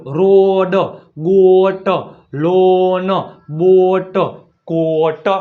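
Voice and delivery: "રોડ, ગોટ, લોન" slow, each O held long; stretched O vowel. Shown as a demonstration. Teacher showing O before A, "રોડ" stretched out. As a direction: drag out the O in "બોટ, કોટ", final consonant separate. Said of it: "ઓનો ઉચ્ચાર શું થાય?".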